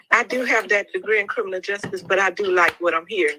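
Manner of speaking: normal talking voice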